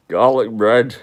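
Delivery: loud Bolton comic relish on garlic bread; Peter Kay impression. Streamer doing Peter Kay for the chat, loud and relishing. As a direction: savour it like a northern comedian. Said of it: "he looks like Peter Kay".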